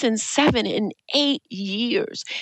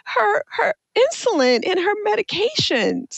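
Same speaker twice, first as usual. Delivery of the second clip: real crying voice, pitched high; choked up and struggling to get it out; tearful; 9 semitones higher than usual. Real, not acted. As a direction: talk through tears, voice lifting high. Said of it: "I already gonna cry".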